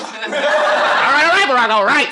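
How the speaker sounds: high, loud giving-in shout; comic yelled surrender, pitch way up; exasperated yell. Acted. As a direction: holler it at the president as if conceding. Acted exasperated reply to Barack.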